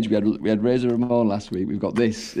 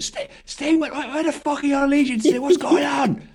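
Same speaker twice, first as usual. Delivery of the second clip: mock scream. Animal confronting Sting about allegiances, with a high mock scream. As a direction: scream it like you hate him. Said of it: "he screams it at Sting".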